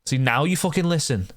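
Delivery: high frustrated shouts; angry yelling. Real, not acted. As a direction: bark orders at the villagers. Yelling at villagers.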